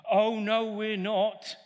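pantomime shout-back. Shown as a demonstration. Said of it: "pantomime call and response".

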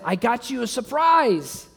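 high, eager announcement; acted excitement. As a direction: play a husband bursting with a surprise. Acting excited husband with surprise.

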